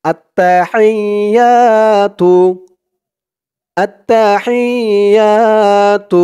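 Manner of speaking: التحيات drawn out slowly with a clear throaty ح; Arabic recitation, slow and clear. Shown as a demonstration. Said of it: "খেয়াল করবেন, উচ্চারণগুলো খেয়াল করবেন".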